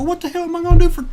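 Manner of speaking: fast, high, loud retort; exasperated. Retold or imitated. Guest protesting the long wait to the clerk, exasperated.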